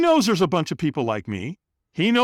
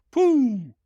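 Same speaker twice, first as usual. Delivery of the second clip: high vocal whoosh; imitated launch sound, pitched high; whoosh noise; 11 semitones higher, 4 dB louder than usual. Storyteller mimics himself taking off across coals, high whoosh.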